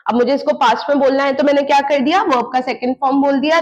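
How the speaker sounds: ordinary delivery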